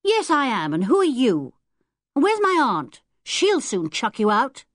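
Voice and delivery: put-on a rude young boy taunting strangers. Ten-year-old telling strangers his aunt will chuck them, rude. Boy rudely confronts visitors.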